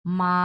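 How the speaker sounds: "মা" held long on one level pitch; sustained, unwavering level tone on "মা"; flat first tone. Shown as a demonstration. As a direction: draw "মা" out evenly from end to end. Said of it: "ফ্ল্যাটভাবে উচ্চারণ করছি".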